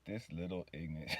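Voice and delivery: low, soft imagined grumble; head-shaking exasperation. Pretend. God shaking his head at the man, quietly exasperated. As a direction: say it under your voice, lightly exasperated. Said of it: "God sitting there just shaking his head".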